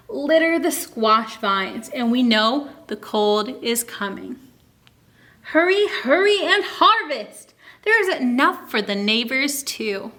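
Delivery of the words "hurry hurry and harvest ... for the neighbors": usual speaking voice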